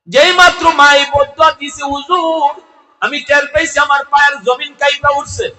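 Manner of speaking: high, shouted sermon chant; full-force preaching. A narrator's reading. Son telling the cleric how his hand began rotting, loud high chant. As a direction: belt out the story on sustained high notes.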